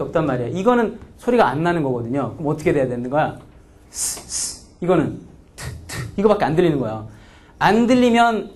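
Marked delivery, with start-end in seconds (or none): 3.9-4.7 스 breathed with no voice at all; voiceless s. Shown as a demonstration. Teacher shows the consonant cannot carry stress, voiceless. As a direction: hiss 스 without voicing. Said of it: "이거 작게 한번 발음해봐".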